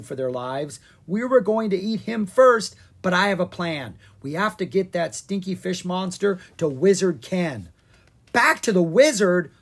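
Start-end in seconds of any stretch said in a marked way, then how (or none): 1.1-7.7 excited. Acted. Ella explains plan mid-flight. 8.3-9.5 higher, louder shocked question; gasp. Acted. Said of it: "Owen gasped".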